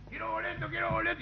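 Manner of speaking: thin high call, softly voiced; mock call from far down the bus; distant calling. Bus guard calling from far back. (llamado agudo y suave, como de lejos)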